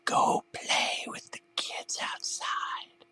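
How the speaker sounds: slow unvoiced whisper; ghostly coaxing whisper. A narrator's reading. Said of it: "the voice whispered".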